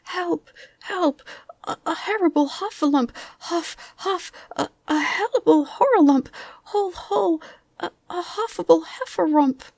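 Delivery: high, loud, tongue-tangled panic; crying out as Piglet. A narrator's reading. Piglet scampering away from the heffalump, crying out. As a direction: stammer out the alarm, squealing. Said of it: "still crying out".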